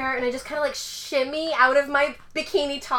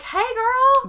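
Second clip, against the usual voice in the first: high chirpy greeting; higher bubbly girl hello; friendly girl greeting; 11 semitones higher, 5 dB louder than usual. Retold or imitated. Quoting friendly message.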